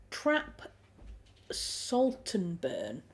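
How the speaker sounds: Yorkshire vowels coming out; slow, careful reading in a Yorkshire accent. Real, not acted. Streamer reading a viewer's name aloud, Yorkshire accent. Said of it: "that's my Yorkshire accent coming out".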